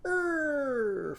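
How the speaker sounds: high, sudden "Er" like a jerky turn; Irving's er sound. Shown as a demonstration. Teacher showing the scooter turn sound, high drawn "Er". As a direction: growl "Er" as the scooter whips round. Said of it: "turn that wheel quickly and go".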